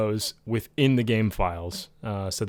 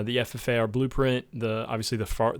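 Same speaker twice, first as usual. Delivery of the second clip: ordinary delivery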